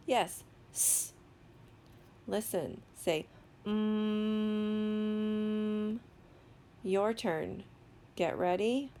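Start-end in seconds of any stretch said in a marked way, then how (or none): 0.7-1.1 "S" as a short voiceless "ss"; fast sound. Shown as a demonstration. Teacher answers "say it fast", "S" said very short. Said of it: "Say it fast". 3.7-6.0 "mm" as one long level hum; slow sound. Shown as a demonstration. Teacher models a sound for children, "mm" held long. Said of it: "say a sound slowly".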